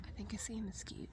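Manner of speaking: fast breathy whisper. Real, not acted. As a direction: whisper it quickly. Whispering in tent at night.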